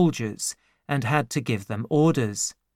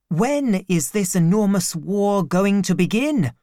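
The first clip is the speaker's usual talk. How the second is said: put-on higher eager boy's voice; eager servant boy; 5 semitones higher than usual. A narrator's reading. Servant boy asks the narrator who they will fight, eager. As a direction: ask it keen for a fight.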